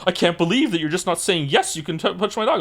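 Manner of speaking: high, pearl-clutching imitation; mock aghast. Strangers told he might bite, high mock-aghast protest. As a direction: gasp it out in hammed-up shock. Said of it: "visibly aghast, like, pearl clutching".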